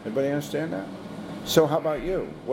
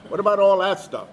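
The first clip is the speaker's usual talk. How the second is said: louder, higher skeptical question; mock challenge; 6 semitones higher, 7 dB louder than usual. Doubter questioning the Bible, mock challenge. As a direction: throw it out like an objector.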